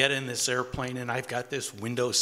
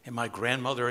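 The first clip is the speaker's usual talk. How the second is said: normal talking voice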